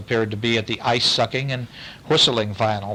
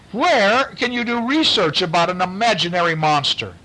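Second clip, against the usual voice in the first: mild, higher-pitched mock shout; a lightly raised excited cry; 7 semitones higher than usual. The professor questioning the moose, a mild mock shout. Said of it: "Professor Wildebeest shouted".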